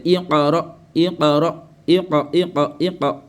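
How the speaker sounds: "iqra" with the q released, i-qa-ra; qalqala. Shown as a demonstration. Teacher corrects the recitation, qalqala echo. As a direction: repeat "iqra", bouncing on each q.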